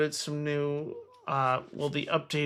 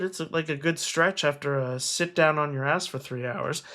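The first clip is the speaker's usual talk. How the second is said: normal talking voice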